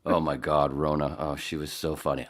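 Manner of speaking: ordinary talk, nothing marked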